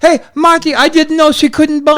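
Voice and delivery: high, broken-English excuse; Iron Sheik impression. Sheik excusing himself to Marty, high impression. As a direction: plead innocence in a high wrestler voice.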